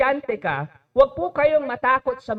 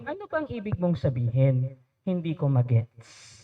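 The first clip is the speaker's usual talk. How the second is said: lower, softer, calm question; calm asking demo; 9 semitones lower, 6 dB quieter than usual. Shown as a demonstration. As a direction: ask it gently, without heat. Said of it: "pwede mong sabihin". (mahinahong tanong)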